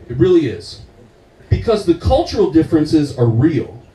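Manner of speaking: ordinary delivery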